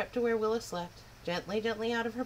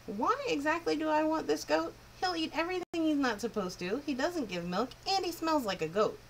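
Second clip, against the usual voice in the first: put-on higher, disbelieving girl's voice; a lightly voiced doubtful girl; Willa, sceptical girl; 8 semitones higher than usual. Willa doubting the wind's gift, higher and sceptical.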